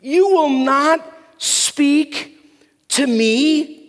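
slow, high, emphatic acted disbelief; incredulous, angry Pilate. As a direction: demand it word by word, playing an affronted governor. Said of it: "He couldn't believe it".

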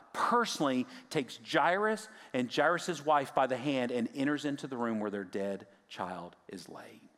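normal talking voice

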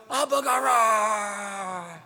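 drawn-out war cry, then a call; battle cry. Retold or imitated. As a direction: roar it like an army charging. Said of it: "And everybody goes".

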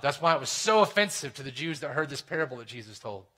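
ordinary talk, nothing marked

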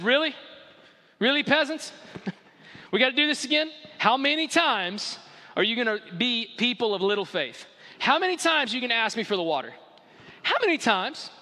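high-pitched, sarcastic and fed up; exasperated Moses. Acted. Moses scolds the complaining people, sarcastic and exasperated. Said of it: "He looked at them and said".

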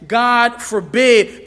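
forceful, drawn-out "God forbid"; emphatic. Acted. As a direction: hit both "God" and "forbid" hard. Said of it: "with an emphatic".

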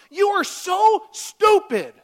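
very loud, very high, slow screamed insult; all-out screamed insult; furious insult. Shown as a demonstration. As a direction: scream it with contempt. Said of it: "That's not bringing it in, in love".

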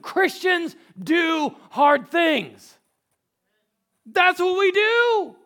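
impassioned sermon climax; full-force preaching. Real, not acted. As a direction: drive it home to the congregation, ending in a roar.

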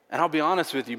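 normal talking voice

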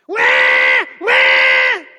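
2 very low, very loud train horn; 3 blast it like a freight train horn. Shown as a demonstration. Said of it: "like a freight train".

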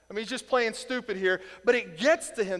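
usual speaking voice

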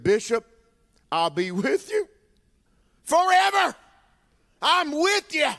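high, loud, gushing "forever"; mock gushing promise. Preacher mocking people's empty vows to the bishop, gushing and high. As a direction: send up an empty promise of loyalty. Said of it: "my pet peeve".

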